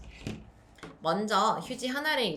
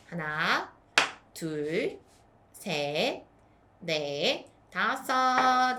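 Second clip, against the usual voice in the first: slow counting; much slower than usual. Shown as a demonstration. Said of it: "한번 쌓아볼까".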